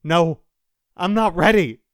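loud, high cry of refusal; desperate protest. A narrator's reading. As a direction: cry out against being taken. Dying man protests to the sky.

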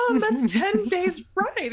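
high, slow, incredulous objection; mock disbelief. Acting heroine's doubtful reply.

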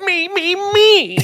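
very high, squeaky "me, me, me"; a spoiled child-king demanding; hammed-up whiny cartoon king. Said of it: "the king's mine".

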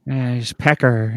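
slow, lightly voiced Coolidge drawl; Jennifer Coolidge impression. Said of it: "It's Jennifer Coolidge".

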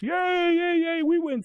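high, loud mock cheer; cheering students. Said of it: "the other side is".

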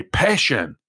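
demonstrating a passionate delivery; with intensity. Shown as a demonstration. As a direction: say the word as if you mean it. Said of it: "same intensity where you expected".

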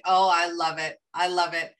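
normal talking voice

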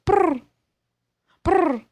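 high chirpy cat trill. Retold or imitated. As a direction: trill like a little bird. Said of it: "she sounds like a bird".

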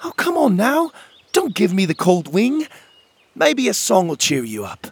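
high, coaxing, playful charm. A narrator's reading. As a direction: coax the shy fairies out with charm. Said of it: "muster a little more charm".

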